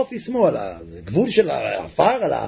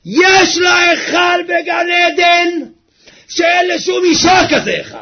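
slow, high, loud proclaiming; fervent sermon shout; preaching at full force; 13 semitones higher, 6 dB louder, slower than usual. Acted. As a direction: declare it to the crowd.

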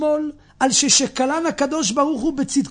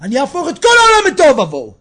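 loud, thundering threat from heaven; heavenly voice; 4 semitones higher, 9 dB louder, faster than usual. Acted. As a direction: burst out high and loud, then rush the threat. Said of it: "יצא בת קול ואמרה". (בת קול מאיימת ברעם)

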